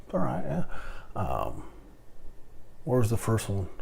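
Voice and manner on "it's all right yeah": higher, breezy no-worries voice; easygoing reply. Retold or imitated. Answering a clerk's thanks, high breezy reassurance. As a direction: brush it off lightly, sing-song. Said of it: "And I'm".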